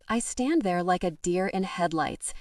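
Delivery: ordinary delivery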